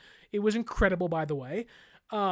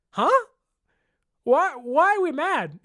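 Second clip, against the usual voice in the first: high, loud incredulous huh; baffled; 7 semitones higher, 7 dB louder than usual. Retold or imitated. His first reaction to the fans' anger, loud and baffled. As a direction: sound truly thrown.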